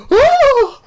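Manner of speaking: loud, very high yelp; mock pained howl. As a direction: imitate a man howling in pain. Acting Kevin Hart shot in hand.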